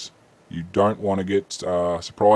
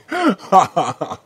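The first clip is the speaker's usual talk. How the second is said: loud high laughter trailing off; laughing; 12 semitones higher, 8 dB louder than usual. Real, not acted. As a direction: keep laughing as it fades. Still laughing after "Exactly.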